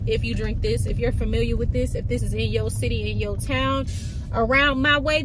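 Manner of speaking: usual speaking voice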